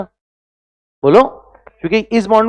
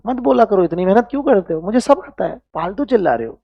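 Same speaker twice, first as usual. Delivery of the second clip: mock know-it-all student; 4 semitones higher, 4 dB quieter than usual. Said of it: "बच्चों का attitude ऐसा होता है".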